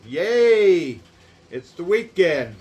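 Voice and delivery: loud, happy whoop; excited cheer. Real, not acted. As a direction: cheer for the weekend. Celebrating Friday.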